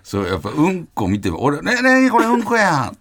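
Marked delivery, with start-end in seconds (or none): 1.6-3.0 high, loud, slow excited calling out; excited Kansai cry. Retold or imitated. Showing how Kansai guys react to dog poop, high and excited. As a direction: point at it like an excited kid. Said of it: "とかやん、俺らは".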